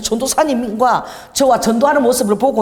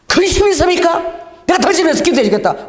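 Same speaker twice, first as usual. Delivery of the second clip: eager call; 8 semitones higher, 4 dB louder than usual. Retold or imitated. Pastor offering prayer loudly.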